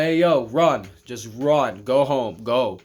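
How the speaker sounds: urgent yell. Retold or imitated. Yelling at his girlfriend to run home. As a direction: shout her away from the fight.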